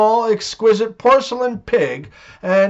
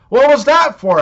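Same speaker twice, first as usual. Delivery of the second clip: louder, higher protest after a slap; indignant yelp; 6 semitones higher, 5 dB louder than usual. Acted. Slapped husband yelps at his wife, louder and indignant. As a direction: yelp it, stunned and stung.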